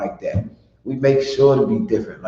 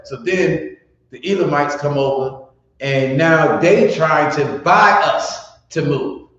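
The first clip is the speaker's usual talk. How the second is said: ordinary talk, nothing marked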